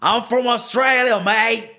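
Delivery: high, exaggerated Australian accent, stretched slow; hammed-up Aussie, drawn out on Australia; mock Australian accent. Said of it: "Le dije que yo me llamaba John from Australia". (acento australiano exagerado y burlón, agudo y lento)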